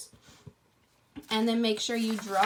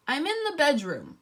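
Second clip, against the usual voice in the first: wobbly voice; 5 semitones higher, 5 dB louder than usual. Acted. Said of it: "in a strange, wobbly voice".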